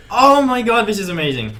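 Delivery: excited. Retold or imitated. Retold excited reaction.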